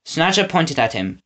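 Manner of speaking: usual speaking voice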